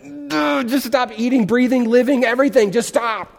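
high-pitched imitation of a parent at wits' end; exasperated parent. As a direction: plead in mock exasperation, like a worn-out parent. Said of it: "you as a parent, you've ever said those words".

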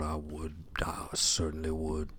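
breathy whispered aside; whispered longing for the award. Acted. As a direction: whisper it, almost to yourself.

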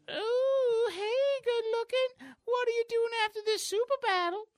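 very high, hammed-up coquettish come-on; sing-song mock seduction; flirty compliment. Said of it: "maybe a compliment will have the reverse effect".

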